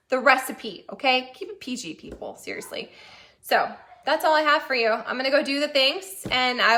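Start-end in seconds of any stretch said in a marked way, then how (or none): 1.3-2.9 soft whispered warning; whispered joke. Real, not acted. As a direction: hush it like a naughty aside. Joking warning to viewers.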